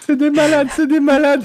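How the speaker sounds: high, loud outraged shout; movie quote voice. Retold or imitated. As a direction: bellow it like the film line. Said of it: "c'est dans Les Visiteurs".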